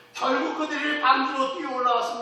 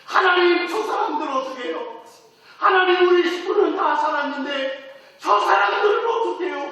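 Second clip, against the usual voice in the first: tearful prayer; 6 semitones higher than usual. Acted. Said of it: "울면서 막 기도했을 거예요".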